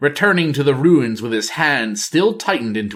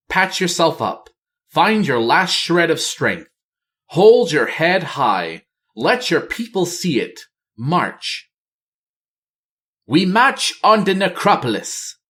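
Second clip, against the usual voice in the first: higher voice rallying her troops; resolute queen; 6 semitones higher than usual. Acted. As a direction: steel them for the last march. Talanji rallies her soldiers.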